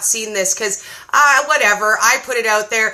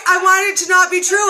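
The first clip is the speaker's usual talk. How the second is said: ordinary talk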